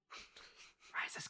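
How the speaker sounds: slow hushed whisper; dramatic whisper. Pretend. Comparing the scene to Star Wars, dramatic whisper. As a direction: breathe it like a secret reveal.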